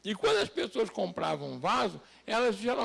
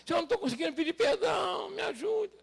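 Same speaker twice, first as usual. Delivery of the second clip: pleading prayer; 9 semitones higher than usual. Acted. Believer confessing to God and asking help, pleading prayer. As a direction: beg for help in a much higher voice. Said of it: "Chegar pra Deus e falar".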